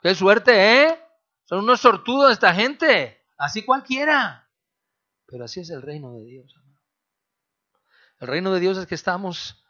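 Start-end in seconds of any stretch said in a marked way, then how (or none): 0.0-4.5 loud, higher mocking grumble of the lucky ones; mock envy. Preacher mocks those envious of the lucky young generation, loud and higher. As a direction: jeer at how easy they have it.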